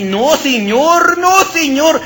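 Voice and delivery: high, loud, slow pleading wail; pleading cry. Acted. Startled maid pleads with her boss, wailing high. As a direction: beg him to stop, voice climbing.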